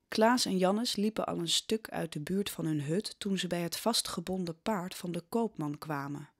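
ordinary talk, nothing marked

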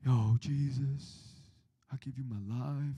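slow, mock-moved tough guy; tearful gangster. Gangster at the altar shedding a single tear, slow mock-solemn surrender. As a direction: imitate a gangster giving in, drawn out and softening. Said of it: "they shed a single gangster tear".

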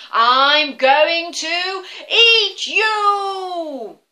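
high, loud, very slow troll threat; menacing troll. Acted. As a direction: stretch the threat like a monster. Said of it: "said the Troll".

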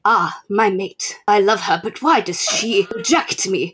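a hint of overwrought romance hero; mock audiobook narrator. Reader mocks the audiobook's male narrator, lightly hammed-up. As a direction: lightly ham up a lovesick alien. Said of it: "he narrates everything".

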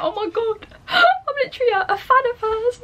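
high-pitched excited squeal with a laugh; squealing fangirl excitement. Retold or imitated. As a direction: gush like a starstruck fan. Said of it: "Here's me getting all gassed about Grace".

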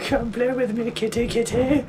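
high, teasing echo of the villain; sing-song it back like a creepy villain; panto crowd callback. Retold or imitated. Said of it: "game character says the line first".

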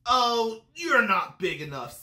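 loud dismissal, high drawn "Oh"; grouchy bug backing out with bluster; grouchy ladybug. A narrator's reading. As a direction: brush them off loudly. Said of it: "said the grouchy ladybug".

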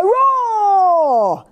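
high, long falling play roar; little tiger roar. A narrator's reading. Little tiger springing out to scare, high roar. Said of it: "I know you can roar".

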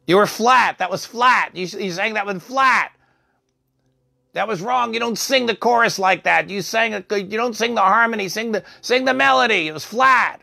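high-pitched, crotchety imitation; scolding patient. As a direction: tell off a bad singer in a high voice.

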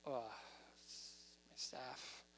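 low, hushed and slow, with a hesitant uh; drawn-out, barely voiced reluctance; reluctant mumble. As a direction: admit it sheepishly under your breath. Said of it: "Or was he like".

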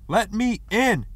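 high, loud, drawn-out holler; hollering at a locked door. A narrator's reading. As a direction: holler slowly to be let in. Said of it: "he hollered".

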